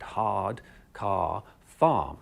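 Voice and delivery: "hard, car, farm" with long open A and no R; R dropped. Shown as a demonstration. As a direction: say "hard, car, farm" slowly, back of the tongue down, R silent. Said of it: "making R sounds".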